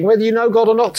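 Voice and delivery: usual speaking voice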